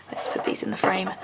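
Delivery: hushed aside while handling gifts; whisper. Real, not acted. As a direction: whisper as if not to break the moment. Placing art carefully in frame.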